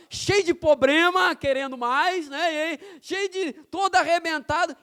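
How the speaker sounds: full-force preaching. Real, not acted. Preacher tells the congregation God uses flawed humans, full-force preaching. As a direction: punch out each failing, loud and high.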